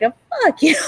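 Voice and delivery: high, hammed-up mock outburst; imitated retort. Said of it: "she's like".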